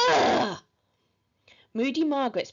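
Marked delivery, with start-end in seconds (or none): 0.1-0.6 loud, rough "ugh" of revulsion; revolted noise; disgusted groan. A narrator's reading. Henry's disgust at the flyer.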